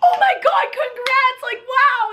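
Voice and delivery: high squealing congratulations; excited fangirls. Retold or imitated. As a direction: imitate girls gushing at full pitch. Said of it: "girls were, like, w-, walking by".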